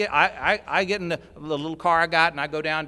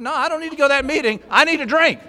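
loud, higher, quick refusal; insistent refusal; 8 semitones higher, 9 dB louder than usual. Retold or imitated. Newcomer refusing the sponsor's meeting, loud and fast. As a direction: brush the offer off fast and firm.

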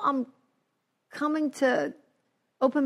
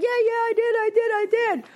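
squeaky, high-pitched nagged voice; whiny high self; 12 semitones higher, 4 dB louder than usual. Retold or imitated. Answering her nagging sponsor in a squeaky high voice. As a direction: pipe up high like a scolded kid. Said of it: "I was like".

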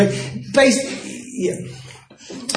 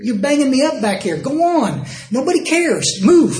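irritated snapping; 7 semitones higher than usual. Retold or imitated. To the man backing onto his foot, an annoyed retold snap. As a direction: tell the big guy off, getting sharper.

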